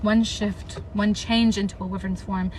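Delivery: steady, even read-aloud of the battle scene; story narration. A narrator's reading. Vlogger reading her novel aloud to viewers, even narration. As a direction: read the passage straight, like narrating a book.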